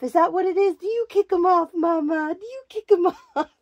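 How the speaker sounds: high, sing-song cooing to a doll. Real, not acted. Teasing the doll about kicking off shoes, high cooing. Talking baby talk to doll.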